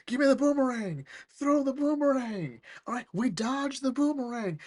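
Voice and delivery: high, excited fight calls; frantic villain. Acted. Acts villains in boomerang fight.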